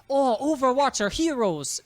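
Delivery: a hammed-up, half-Scottish take on Tracer; mock Tracer accent. Mocking Tracer's cheery hero line in a put-on accent. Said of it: "What was that accent?".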